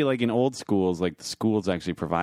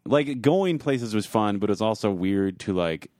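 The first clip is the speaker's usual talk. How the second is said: ordinary talk, nothing marked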